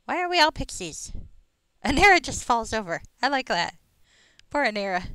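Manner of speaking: high pixie voice, slipping; a fading squeaky fairy. Pretend. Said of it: "my pixie voice".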